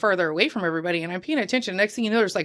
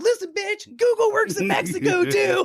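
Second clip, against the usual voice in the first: much higher, mock furious retort; hammed-up angry snap at the tout, much higher; mad comeback; 12 semitones higher than usual. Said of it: "Like, I'm so mad".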